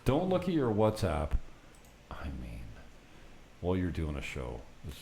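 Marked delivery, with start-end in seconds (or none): none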